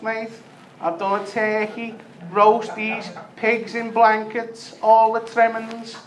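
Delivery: high, pleading scouse chancer voice; Smackhead Tony. Retold or imitated. Beggar at the door asks for tinfoil, high wheedling voice. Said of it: "he's like".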